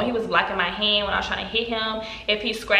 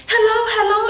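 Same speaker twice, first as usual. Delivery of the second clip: high, anxious calling; scared mother; 13 semitones higher, 6 dB louder than usual. Retold or imitated. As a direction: call out worried on the phone. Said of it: "She's like".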